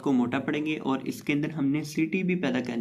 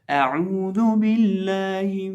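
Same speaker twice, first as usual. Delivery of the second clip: slow, higher chanted recitation; Quranic recitation; 5 semitones higher, much slower than usual. Acted. Recites taawwuz and basmala.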